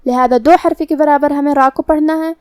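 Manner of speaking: ordinary delivery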